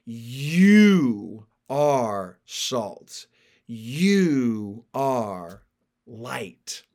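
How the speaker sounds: long, heavy stress on each "You"; very slow, spaced words with YOU swelling; emphatic YOU. Shown as a demonstration. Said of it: "It's emphatic".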